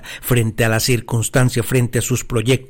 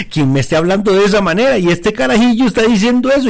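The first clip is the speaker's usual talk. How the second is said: exasperated Saul; 9 semitones higher, 3 dB louder, faster than usual. Retold or imitated. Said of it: "agarrándose la jupa y diciendo".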